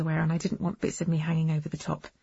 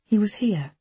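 ordinary delivery

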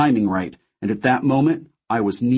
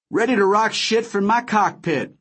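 brash pilot declaring; 10 semitones higher than usual. A narrator's reading. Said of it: "Wody declared".

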